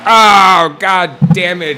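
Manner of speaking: loud, high exasperated cry; frustrated outburst. Real, not acted. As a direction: blurt it out, annoyed with yourself. Reacts to a mistake.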